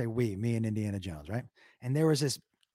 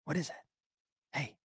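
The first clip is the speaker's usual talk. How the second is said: hushed, unvoiced whisper to the dog; 11 dB quieter, faster than usual. Eggs on his dog about the geese in a whisper. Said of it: "if I whisper".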